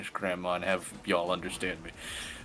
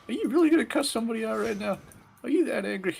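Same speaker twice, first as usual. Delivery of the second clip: high, fast, mock-prissy scolding. Scolding himself for wanting to cuss, high and prim. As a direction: chide like a prim hall monitor. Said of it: "I'm like".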